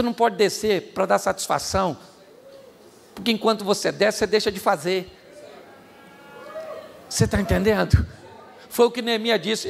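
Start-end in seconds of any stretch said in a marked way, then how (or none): none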